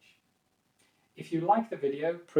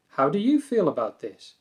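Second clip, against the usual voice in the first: "How do you feel about this?" louder, lifted on YOU, then falling; raised questioning intonation; 2 semitones higher, 7 dB louder than usual. Shown as a demonstration. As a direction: ask with interest, raising "you", not aggressive. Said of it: "So raising the intonation".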